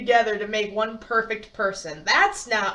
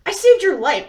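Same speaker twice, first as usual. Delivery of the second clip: sharp retort; 6 semitones higher, 8 dB louder than usual. Acted. Edith retorting to Bo.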